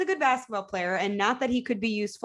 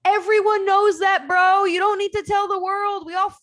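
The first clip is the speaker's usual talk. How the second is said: exasperated outburst; 10 semitones higher, 5 dB louder than usual. Real, not acted. Heated sports argument.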